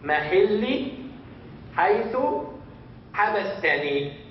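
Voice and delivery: slow teaching recitation; slow and clear. Shown as a demonstration. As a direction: recite it slowly for the class. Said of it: "Only this sentence".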